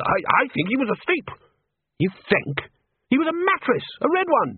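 high-pitched squabbling; agitated argument. A narrator's reading. Wizards blame each other in the sea, high agitated squabble. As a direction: voice jumps up high in protest. Wizards arguing while treading water.

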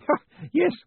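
high, nervous laughing Spools; a hint of an unsure grin; uncertain grin. A narrator's reading. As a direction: chuckle along without quite getting it. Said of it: "grinning uncertainly".

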